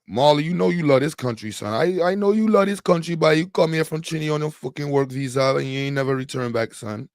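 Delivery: put-on Trinidadian accent. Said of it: "My Trinity accent trash".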